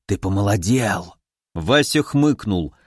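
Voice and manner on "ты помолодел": put-on astonished old man. A narrator's reading. Old hermit confirms the visitor got younger, low wonder. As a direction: sink low in wondering certainty, lightly aged voice.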